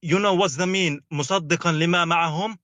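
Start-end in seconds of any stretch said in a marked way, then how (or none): none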